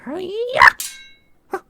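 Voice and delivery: high, loud mock strike yell; sparring cry. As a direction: shout it as you swing the staff. Mock strike during staff training.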